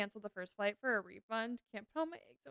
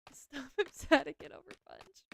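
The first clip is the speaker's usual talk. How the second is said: normal talking voice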